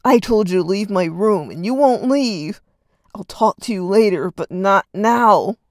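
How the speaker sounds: loud, insistent shouting; loud demand. Acted. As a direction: order him out, slowing at the end. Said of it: "Reed demanded".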